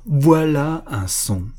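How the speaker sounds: put-on an old man overcome by his own sound; moved exclamation. A narrator's reading. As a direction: let the awe burst out. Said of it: "d'une voix profondément émue".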